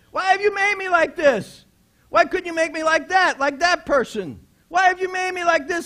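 high, loud, aggrieved wail at God; mock complaint shouted up at God; loud complaining cry. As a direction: cry it out like a hurt child blaming God.

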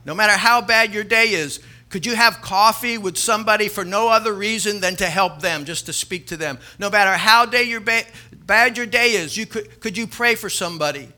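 loud, driving preacher's call; full-force preaching. Acted. Pastor urging the congregation to help others, loud preaching.

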